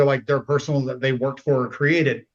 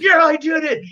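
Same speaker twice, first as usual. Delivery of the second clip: much higher, goofy pride; proud dumb jock; 12 semitones higher, 4 dB louder than usual. Retold or imitated. Jock bragging about his stunt, much higher. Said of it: "He's like".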